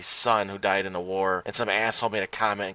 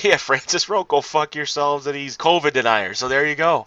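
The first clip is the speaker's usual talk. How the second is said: laughing while reading; 4 semitones higher, 4 dB louder than usual. Real, not acted. Cracking up reading Francis' comment.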